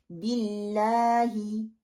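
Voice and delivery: बिल्लाही with a light thin "l", short "bi"; thin lam. Shown as a demonstration. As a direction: recite बिल्लाही slowly, the "ll" kept light. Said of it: "बारीक पढ़ते हैं".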